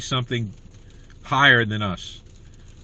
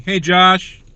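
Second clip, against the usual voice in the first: louder, higher greeting call; 5 semitones higher, 7 dB louder than usual. Real, not acted. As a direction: call out to get his attention. Calls out to Josh.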